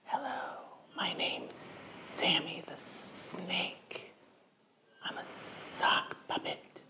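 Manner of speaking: whispered sock puppet voice; breathy whisper, like a shy puppet; Sammy the Snake puppet. Acted. Sock puppet introduces itself.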